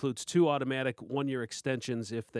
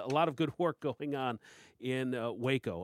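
ordinary talk, nothing marked

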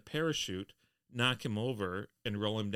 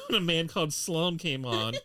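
usual speaking voice

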